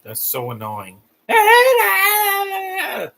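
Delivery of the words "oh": high, loud anguished wail of an oh; a pained, drawn-out wail of annoyance; anguished cry. Real, not acted. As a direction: cry out in real exasperation. Annoyed at a character singing.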